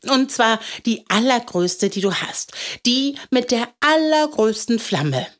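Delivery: put-on determined little boy. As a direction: insist like a small boy with a big wish. Voices eager little boy Willi.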